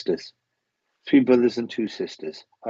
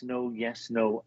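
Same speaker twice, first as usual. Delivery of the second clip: ordinary talk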